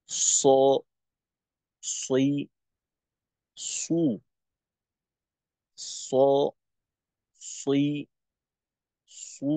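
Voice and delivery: heavy emphatic স with fatha, kasra, damma; ṣad letter sounds. Shown as a demonstration. Teacher demonstrating the heavy ṣad sound to students. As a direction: say স, সই, সু thick and full-mouthed. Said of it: "সদের উচ্চারণটা হবে এমন".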